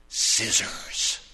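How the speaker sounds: low, unvoiced defiant word; through gritted teeth. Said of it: "she gritted her teeth and".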